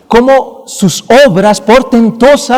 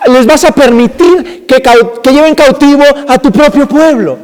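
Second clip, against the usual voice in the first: higher, pleading protest to God; a prophet questioning God, higher and pleading; prophet's anguished complaint; 7 semitones higher than usual. Acted. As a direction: cry out to God as the distraught prophet. Preacher voices prophet's complaint to God.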